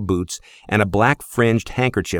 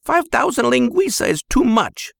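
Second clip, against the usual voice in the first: mother yelling. Acted. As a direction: shout at the husband like an angry mother. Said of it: "she yelled in Pop's ear".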